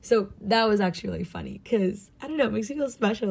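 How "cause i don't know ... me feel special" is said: ordinary talk, nothing marked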